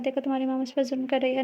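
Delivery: usual speaking voice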